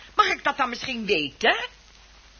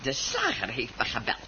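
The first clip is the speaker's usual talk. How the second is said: zacht onthullend; 10 semitones lower, 8 dB quieter than usual. Acted. Vrouw onthult belastend nieuws.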